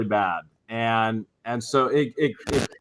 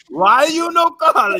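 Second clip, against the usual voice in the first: much higher, slow shout, stress on no; Mama George shouting; 6 dB louder, much slower than usual. Retold or imitated. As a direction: drag it out in an outraged yell. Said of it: "That entire impersonation".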